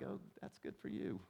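dismissive. Retold or imitated. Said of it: "they're like".

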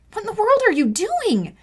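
stunned big sister; shocked. Acted. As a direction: blurt it out in alarm. Pulling brother from bottle.

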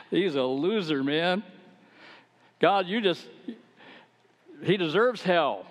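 mock contempt for the so-called loser; scornful disbelief.

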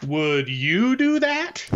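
slow, drawn-out challenging question; mocking the skeptic. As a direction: mimic the opponent's slow pointed question. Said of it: "That's, that's the way he said it".

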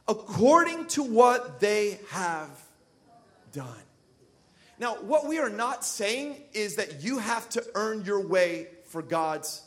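ordinary talk, nothing marked

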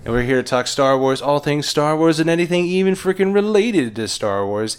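loud, upbeat announcer welcome; podcast host intro. Acted. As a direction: open the show like a host.

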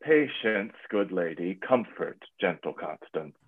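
quiet consolation to a grieving mother; soothing. Acted. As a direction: hush her grief with a soft, low voice. Soothing a distraught lady.

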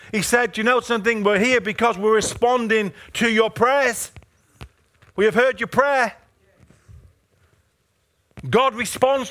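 high, driving full-force sermon; impassioned preaching. Real, not acted. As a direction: preach it urgently, pitched high and pressing.

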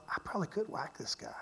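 fast unvoiced whisper to himself; whispered thought. David whispering his thought about killing the king. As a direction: breathe it like a secret temptation. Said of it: "he thinks to himself".

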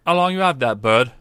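street sweeper. Acted. Sweeper pressing the stranger about his bird, loud and pushy. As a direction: lean in and demand it loudly.

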